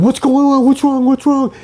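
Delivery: high, loud, rushed calling out; worried friends calling. Retold or imitated. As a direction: friends shouting from the boat in alarm. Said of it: "everybody's going".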